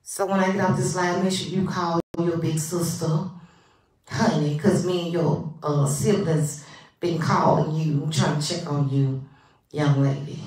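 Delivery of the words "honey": normal talking voice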